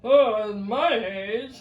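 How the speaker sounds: put-on slow, loud creaky old geezer; old man voice. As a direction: drag it out like an old grandpa. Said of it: "Easy there, [name]".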